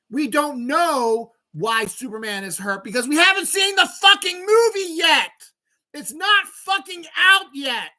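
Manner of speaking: high, loud rant building to all-out screaming; real furious yelling. Real, not acted. Streamer blowing up at trailer complainers, furious yelling. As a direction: lose it completely, stretching the final insults. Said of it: "I was yelling".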